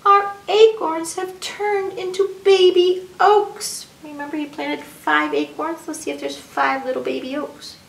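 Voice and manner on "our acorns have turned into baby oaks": high, loud, stretched-out exclamation; amazed excitement. A narrator's reading. Young rabbit discovers the acorns have sprouted, amazed excitement. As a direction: marvel like a delighted child.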